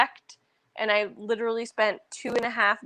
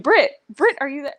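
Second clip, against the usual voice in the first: calling out; 5 semitones higher, 6 dB louder than usual. Retold or imitated. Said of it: "she was just like".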